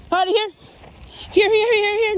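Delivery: high, loud calling; excited recall. Real, not acted. Trainer calling Harley back, excited and loud. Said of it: "Now I'm getting excited".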